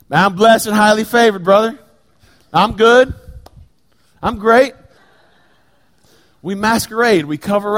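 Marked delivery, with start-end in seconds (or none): 0.1-4.7 higher, louder hearty church greeting; breezy churchgoer. Retold or imitated. Churchgoer answering a Sunday greeting, higher and louder with hearty cheer. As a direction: brush it off with a big cheerful front.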